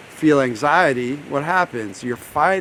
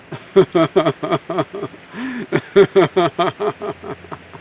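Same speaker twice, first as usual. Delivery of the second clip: long rolling laugh, louder and higher; deliberate laugh; 5 semitones higher, 5 dB louder than usual. Shown as a demonstration. Therapist leading listeners to laugh it out, deliberate laughter. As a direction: laugh it out in repeated bursts. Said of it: "let's laugh it out".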